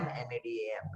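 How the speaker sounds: quiet repeat of the spelling; soft spelled. Shown as a demonstration. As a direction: say "M A D A M" softly aloud. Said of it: "What is a palindrome".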